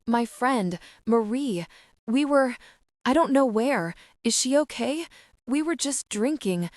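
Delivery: high, frantic, breathless pleading; words tumbling out between gasps; a terrified young woman asking for her missing friend, high and frantic; panicked sobbing. A narrator's reading. Panicked victim sobbing, gasping.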